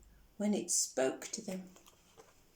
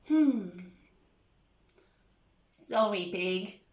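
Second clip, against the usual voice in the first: lower, sheepish apology; guiltily; 4 semitones lower than usual. Acted. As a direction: Goat owns up, a little ashamed. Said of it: "said Goat guiltily".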